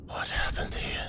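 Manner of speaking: whispered, wary question; hushed whisper. Acted. Son finding his mother in the ruin, whisper. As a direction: breathe the question out.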